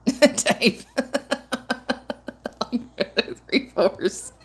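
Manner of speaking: bursts into real laughter; laughing. Real, not acted. Laughing at chat message.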